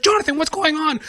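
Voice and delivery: high-pitched urgent questioning; alarmed neighbor. Acted. Neighbor bursts through the front door, asking in loud alarm. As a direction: cry out as the panicked neighbor. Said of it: "my neighbor, and he's like".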